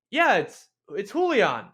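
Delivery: high, bright-ended reply with a drop on "Julian"; familiar, like a best friend. Shown as a demonstration. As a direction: answer like an old buddy. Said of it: "reply as if they know me again as a best friend".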